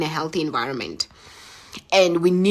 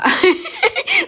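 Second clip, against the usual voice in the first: high laugh; 5 dB louder than usual. Real, not acted. Laughing after crying.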